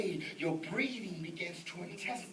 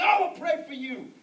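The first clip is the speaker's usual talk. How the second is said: retold loud retort; 10 semitones higher, 12 dB louder than usual. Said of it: "I said".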